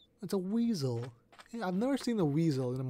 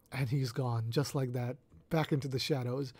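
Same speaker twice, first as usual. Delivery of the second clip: hushed mock narration; 5 semitones lower, 7 dB quieter than usual. Mock documentary narration, creature leaves.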